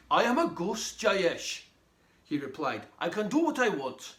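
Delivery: lively read-aloud storytelling; story narration. Acted. Author reading chapter one to young listeners, lively storytelling. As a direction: read it to a room of children, animated.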